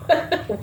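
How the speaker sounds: a short high burst of real laughter; laughing. Real, not acted. Cracks up at the holy trash panda. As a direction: break into a laugh at the silly card combo. Reading a funny card combo.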